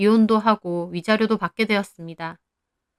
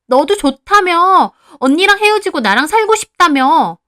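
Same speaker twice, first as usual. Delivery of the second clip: very high, loud accusing retort; angry quarrel; 9 semitones higher, 9 dB louder than usual. A narrator's reading. Heeju confronts her lover with his promises, shrieking accusation. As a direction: fling his promises back at him. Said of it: "둘이 대놓고 욕하며 싸우더라고요".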